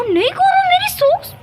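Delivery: high, rising squeal at the pet; squealed protest. Real, not acted. Pet grabbing her socks. (ऊँची आवाज़ में चिल्लाकर रोकते हुए)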